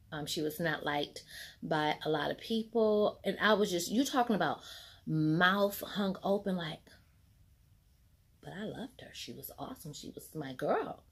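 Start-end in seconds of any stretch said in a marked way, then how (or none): none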